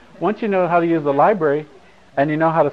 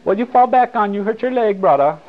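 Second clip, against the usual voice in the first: higher, louder teasing shopkeeper voice with a Hawaiian pidgin lilt; video store lady; 7 semitones higher, 5 dB louder than usual. Retold or imitated. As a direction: tease him like the lady at the video place, calling him brother. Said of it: "the lady said".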